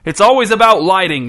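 high, loud exasperated shout. A narrator's reading. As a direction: yell it out in frustration. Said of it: "shouted Hal".

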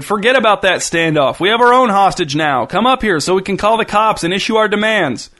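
high, exasperated yell through gritted teeth; annoyed shout. A narrator's reading. Gunman orders his partner upstairs, annoyed shouting. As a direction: shout impatiently to someone in another room. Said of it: "gritted his teeth in annoyance and shouted".

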